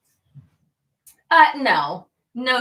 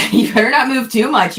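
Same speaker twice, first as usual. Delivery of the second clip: laughing warning; 5 semitones higher, 11 dB louder than usual. Real, not acted.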